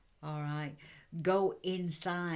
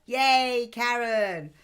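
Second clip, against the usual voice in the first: loud, high cheer for a viewer; big happy shout of thanks; excited cheer; 6 semitones higher, 12 dB louder, much slower than usual. Real, not acted. Medium cheers Karen for liking and sharing, loud and excited.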